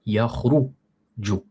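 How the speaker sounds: yakhruju drawn out very slowly, falling; slow Arabic model word; model pronunciation. Shown as a demonstration. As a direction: spell out yakhruju slowly for the class. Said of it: "c'est une dhamma".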